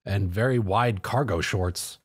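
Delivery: usual speaking voice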